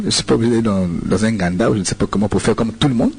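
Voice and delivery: ordinary talk, nothing marked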